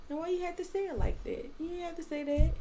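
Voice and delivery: high, soft mock of his aggressive voice; mock aggressive boyfriend. Girlfriend imitating her boyfriend's aggressive tone, pitched high. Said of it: "when he do get aggressive with his voice".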